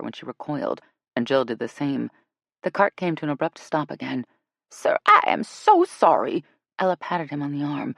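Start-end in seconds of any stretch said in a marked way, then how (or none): 4.7-6.4 high, loud, slow apology; effusive apology. Acted. Ella apologising to the guide, high and emphatic. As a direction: lean on each word to placate him.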